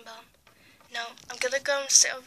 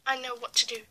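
usual speaking voice

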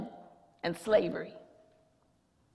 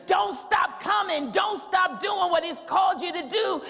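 full-force preaching; 4 semitones higher than usual. Acted. Preacher urging the church to keep going, full-force preaching. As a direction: preach it hard to the congregation.

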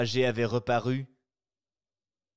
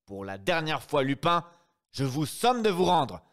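louder, higher ultimatum; angry final warning; 5 semitones higher, 5 dB louder than usual. Acted. Brigadier to Lupin before firing, loud last warning. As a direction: threaten him one last time.

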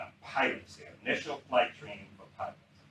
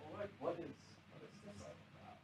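hushed, higher-pitched puzzled prayer; acted quiet, bewildered plea; praying; 6 semitones higher, 23 dB quieter, faster than usual. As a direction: drop to a near whisper, as if praying. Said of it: "and I'm praying".

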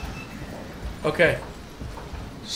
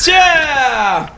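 high, falling battle whoop; excited yell; 7 semitones higher, 4 dB louder than usual. Real, not acted. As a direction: let out a long triumphant yell. Whooping during zombie fight.

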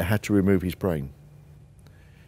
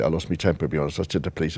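ordinary talk

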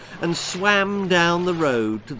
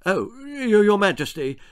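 higher, stammering politician voice; flustered Prime Minister; 6 semitones higher than usual. Acted. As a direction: caught off guard, fumble the title. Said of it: "said the Prime Minister".

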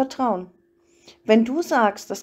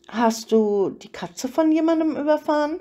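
higher-pitched, playful question; joking; 5 semitones higher than usual. A narrator's reading. Edward jokingly probes why Bella feels unwelcome, playful and teasing. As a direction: tease lightly, voice lifted. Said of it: "fragte er scherzhaft".

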